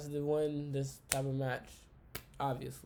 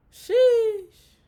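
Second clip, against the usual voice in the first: high, loud sheesh of disbelief; a sudden high-pitched sheesh; loud exclaimed sheesh; 12 dB louder than usual. Real, not acted. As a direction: blurt it out in shock. Reacting to farewell promo.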